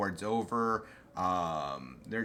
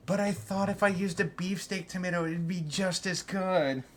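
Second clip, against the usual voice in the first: mock naive voice; 8 semitones higher than usual. Mocking naive cook voice.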